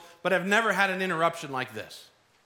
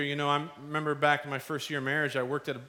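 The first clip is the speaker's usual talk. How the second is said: ordinary delivery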